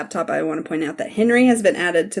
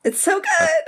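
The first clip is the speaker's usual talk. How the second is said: gushing through a laugh; 13 semitones higher than usual. Real, not acted. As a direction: say it with a delighted laugh breaking in. Gushing about the scene.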